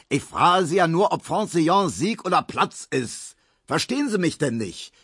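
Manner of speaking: hoch und laut, gereizt; fährt den Begriffsstutzigen an; geschrien. A narrator's reading. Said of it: "schrie der". (Markowski schreit Emil gereizt und hoch an)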